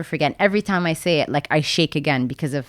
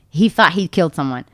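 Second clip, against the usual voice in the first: ordinary talk, nothing marked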